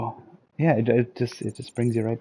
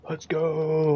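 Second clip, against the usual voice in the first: higher, excited "Let's go"; hyped-up catchphrase; 7 semitones higher than usual. Retold or imitated. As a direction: pump it up like a fan. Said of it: "now everyone says".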